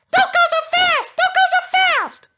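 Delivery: very high, very loud squealing coaches; panicked cry from the carriages; shrill cartoon coaches screaming; coaches shrieking.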